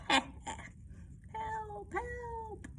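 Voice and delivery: high, laughing mock cry for help; tiny cry for help. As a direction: squeak out a playful help, help. Kittens lost in nettles.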